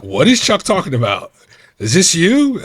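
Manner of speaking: retold demanding boss. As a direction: imitate a boss calling someone out, loudly.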